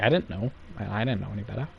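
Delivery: meek mumble. Retold or imitated. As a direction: mumble it like a guilty newbie caught out. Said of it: "He's like".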